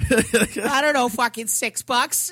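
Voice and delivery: high, drawn-out Boston mimicry; thick Boston accent. Sister answers about the cab fare, thick Boston accent. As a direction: stretch it out in exaggerated Bostonese. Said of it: "the thickest Boston accent".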